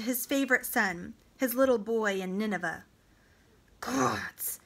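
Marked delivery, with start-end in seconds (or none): 3.8-4.6 low, breath-heavy whispered oath; hushed oath under the breath; inward thought. A narrator's reading. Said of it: "thought Taharka, "how I hate him".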